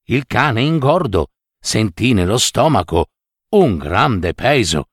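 ordinary delivery

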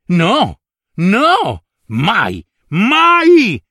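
building from firm no to a high cried mai; climbing refusal that ends in a high, loud cry; anguished refusal. Acted. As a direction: refuse harder each time until it breaks into a cry. Reuccio refuses to forgive brother.

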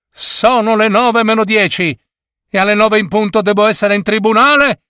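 high-pitched urgent protest, speeding up; lawyer's shout. A narrator's reading. Lawyer due in court berates the bus staff, urgent shouting. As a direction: cry out like a lawyer late for court. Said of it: "gridò un avvocato".

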